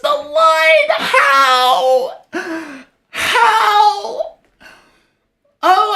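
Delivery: very high, shouted, half-crying joy; overjoyed streamer screaming at a photo finish; ecstatic shouting. Real, not acted. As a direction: yell it out in pure disbelief. Race won on the last note.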